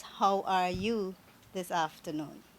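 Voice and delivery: ordinary delivery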